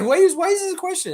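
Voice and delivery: playing OG, stunned by a dumb reporter question; incredulous imitation of OG. Host imitating OG's baffled reaction, loud high mock disbelief. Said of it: "It's like".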